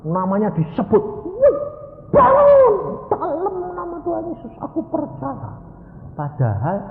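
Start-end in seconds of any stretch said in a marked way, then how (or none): 1.2-5.6 very high cry, loud then easing; imitated shouting. Woman calling her absent husband to rise, imitated shout. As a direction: mimic a wife crying out in frantic faith. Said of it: "Dia teriak manggil suaminya".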